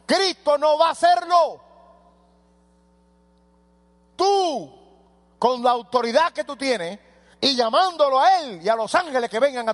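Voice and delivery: high, loud fiery preaching; preaching at full force. Acted. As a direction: thunder it from the pulpit.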